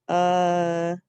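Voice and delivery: Un said alone, firmly; first syllable sounded. Shown as a demonstration. Teacher sounds out the first syllable. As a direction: sound out Un clearly. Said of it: "The first syllable".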